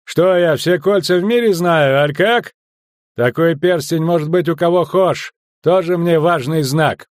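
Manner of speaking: high, loud, dismissive rant; blustering, unimpressed officer; loud scoffing. Acted. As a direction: pour scorn on their credentials. Sergeant scoffs loudly at the ring.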